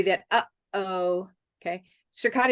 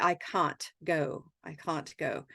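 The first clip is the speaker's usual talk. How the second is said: British English "can't" with a long broad A and a clear T; 5 semitones lower, 6 dB quieter, slower than usual. Shown as a demonstration. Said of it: "like British English".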